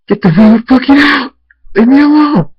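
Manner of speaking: high, loud screamed outburst; furious scream. A narrator's reading. Crying man to mom walking back in, furious scream. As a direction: scream at them to get out.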